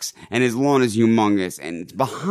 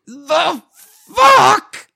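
high, drawn-out scream, building louder; mock screaming; 7 dB louder, much slower than usual. Storyteller reprises the psycho homeowner, hammed-up screaming. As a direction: scream it slowly, exploding on the last word.

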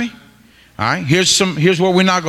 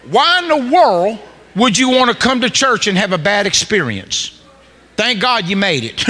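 ordinary talk

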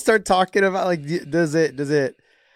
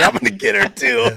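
raised, higher punchline; hammed-up punchline threat; mock threat; 6 semitones higher, 5 dB louder than usual. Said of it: "He's like".

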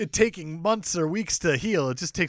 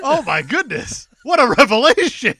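sarcastic; 8 semitones higher, 9 dB louder than usual. Real, not acted. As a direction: fake amazement, loud. Sarcastic reaction.

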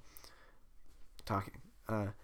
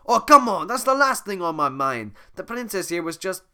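flustered Donkey; 8 semitones higher, 10 dB louder than usual. Acted.

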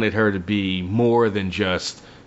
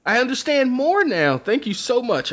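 very high, eager little voice; familiar's voice; 12 semitones higher than usual. Retold or imitated. Narrator voices the familiar thanking her master, very high and eager. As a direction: pitch it way up with childlike gratitude. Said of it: "And she goes".

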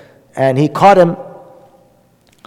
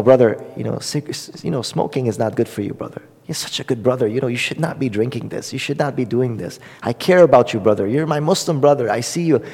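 soft and kindly, like a concerned brother; gentle caring advice; 3 semitones lower, 7 dB quieter than usual. Retold or imitated. Soft caring plea from one brother to another about smoking. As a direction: coax him gently, speaking softer.

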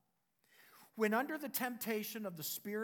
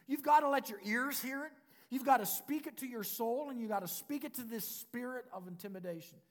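normal talking voice

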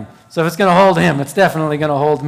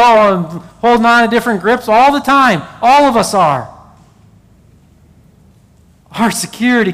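raised, fervent preaching to the congregation; 4 semitones higher than usual. Acted. Pastor urging the congregation, fervent preaching. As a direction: preach it out, rising and insistent. Preacher building to full force.

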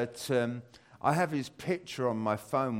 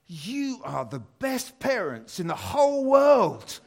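grateful son; 9 semitones higher, 7 dB louder, slower than usual. Retold or imitated. Son's grateful text quoted.

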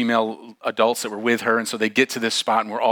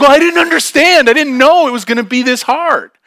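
very high, loud mock wail; hammed-up whining; 11 dB louder than usual. Struggling girl excusing herself on the climb, hammed-up wail. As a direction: wail the complaint in comic despair.

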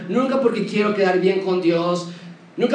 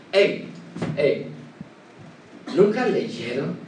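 lower, pointed rebuking question; public rebuke; 4 semitones lower, slower than usual. Shown as a demonstration. The Lord rebukes the religious leaders, pointed rebuke. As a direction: prod them into shame with a question. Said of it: "esto era una reprensión pública".

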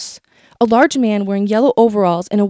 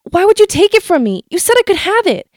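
much higher, louder hurt yell; yelling back; 9 semitones higher, 3 dB louder than usual. Retold or imitated. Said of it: "I yell back".

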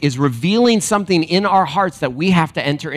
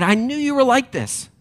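quoting Jonah; 10 semitones higher than usual. Retold or imitated. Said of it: "He said".

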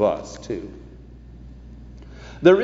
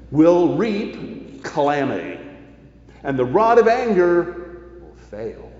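scripture reading; 2 semitones higher than usual. Acted. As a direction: lay each phrase down like a verdict. Reads Proverbs aloud in sermon.

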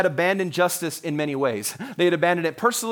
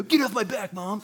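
put-on teen brushing off mom; 4 semitones higher, 4 dB quieter than usual. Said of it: "said that to your parents when you were a teenager".

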